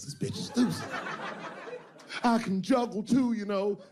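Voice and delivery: high, put-on crazy woman voice. The bottom bitch bragging to her pimp, in a high put-on crazy voice. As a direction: squeal it like she's lost her mind. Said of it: "She started saying".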